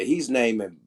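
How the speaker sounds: usual speaking voice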